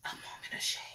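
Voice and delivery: breathy whisper, very soft, like a show segment title; dramatic whispered title. Shown as a demonstration. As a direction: whisper it like a spooky intro card. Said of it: "it's gonna be like".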